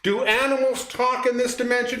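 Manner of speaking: a hint of a nervous cartoon pig; movie pig line. Reading a quiz quote to her partner, light pig voice. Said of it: "Next line".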